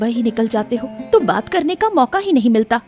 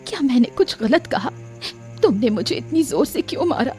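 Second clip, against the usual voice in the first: hurt, sobbing protest; tearful. Acted. Wife just struck by her husband, tearful. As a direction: cry through the question. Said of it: "बेचारी शारदा रोते हुए".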